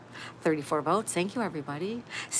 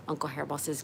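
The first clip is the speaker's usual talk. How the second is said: ordinary delivery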